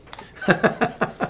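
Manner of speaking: short breathy laugh, rising; real laugh. Real, not acted. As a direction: chuckle at the bright shirt. Laughs about the bright shirt.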